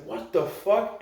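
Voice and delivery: shocked, high-pitched exclamation; mock outburst of alarm; Bautista. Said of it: "Bautista's like".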